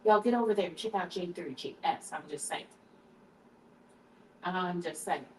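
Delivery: ordinary talk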